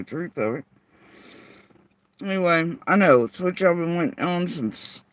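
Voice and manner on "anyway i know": ordinary delivery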